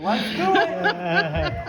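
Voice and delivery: big goofy cheer, slow and loud; excited cheer. Acted. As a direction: shout it like a dopey cartoon hero.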